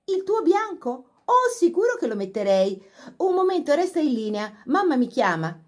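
gushing phone chat. A narrator's reading.

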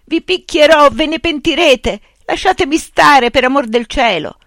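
loud, high desperate shout near tears; sobbing shout. A narrator's reading. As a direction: scream the threat and the plea half sobbing. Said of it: "gridò singhiozzando per l'emozione". (grido disperato, forte e acuto, tra i singhiozzi)

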